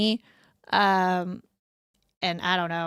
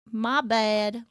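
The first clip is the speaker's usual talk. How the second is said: ordinary delivery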